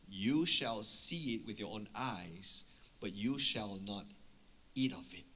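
low, soft, measured pronouncement; grave prophetic reply. Retold or imitated.

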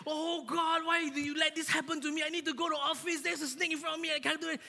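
high, whiny wailing plea to God; mock despair. Worker stuck behind a snake, whining to God. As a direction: wail at heaven in hammed-up despair. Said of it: "you just like".

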